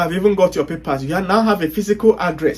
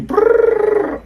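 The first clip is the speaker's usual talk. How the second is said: a quick high brrr like a machine printing; imitated machine whirr; 13 semitones higher than usual. Mimicking ChatGPT churning out a whole book, a high brrr. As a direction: buzz it out like a printer spitting pages.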